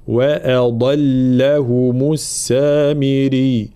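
slow chanted وأضلهم with a heavy dad; careful recitation. Shown as a demonstration. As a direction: draw out وأضلهم, keeping hamza and lam light. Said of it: "وبينهما الضاد مفخمة". (وأضلهم مرتلة بضاد مفخمة)

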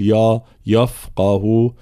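slow يفقه with a thick, dark يا; wrong heavy ya. Shown as a demonstration. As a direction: darken the opening ya of يفقه, as a mistake. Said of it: "بقية الحروف مرققة، فلا نقول". («يفقه» بتفخيم الياء خطأً)

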